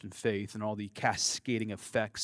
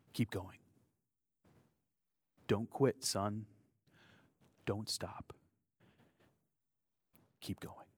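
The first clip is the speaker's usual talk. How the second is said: soft hushed encouragement, fading softer to the end; retold whisper; 5 dB quieter than usual. A narrator's reading. Pianist whispering encouragement to the boy on stage, softly. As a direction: hush it like a secret to a scared child. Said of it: "he whispered to the boy".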